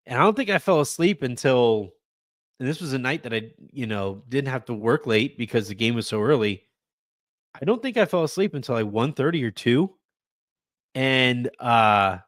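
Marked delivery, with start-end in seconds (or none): none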